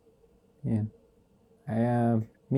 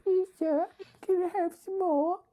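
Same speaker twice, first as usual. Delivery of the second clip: very high pleading orphan voice; Oliver Twist begging. Retold or imitated. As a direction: beg like a small orphan boy. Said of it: "Like Oliver".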